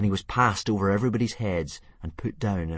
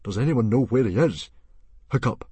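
loud, higher summons to the crowd; calling out; 6 semitones higher, 8 dB louder than usual. Acted. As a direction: call the boy forward.

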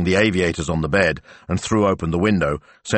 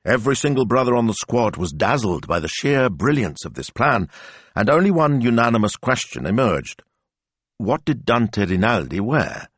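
plain reading voice

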